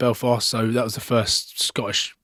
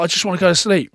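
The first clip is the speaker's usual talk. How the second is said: grumpy; 7 semitones higher, faster than usual. Retold or imitated. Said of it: "He's grumpy as f".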